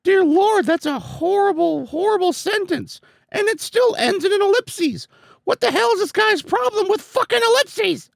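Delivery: high squeaky character voice; high cartoon voice. Voicing game character dialogue.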